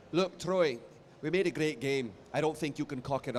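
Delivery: terrible Scottish accent impression. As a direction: put on a bad Scottish accent. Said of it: "I'm gonna do a terrible Scottish accent".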